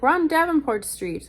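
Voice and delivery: loud, high-pitched imitated call. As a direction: call it out like the pharmacy worker. Pharmacy guy calls out the street.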